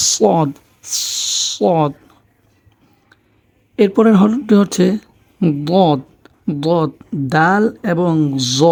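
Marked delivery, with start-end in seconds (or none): 0.1-1.9 সদ with a long, heavy s, tongue slightly turned up; সদ as emphatic "ssod"; tongue-curled Sad. Shown as a demonstration. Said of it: "জিহ্বাটা একটু উল্টিয়ে". 5.7-6.9 দদ opened midway between d and z; দদ as emphatic "ddod"; heavy Arabic Dad. Shown as a demonstration. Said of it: "দুটার মাঝামাঝি".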